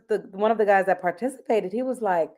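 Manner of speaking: normal talking voice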